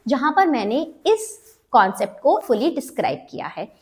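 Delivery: ordinary talk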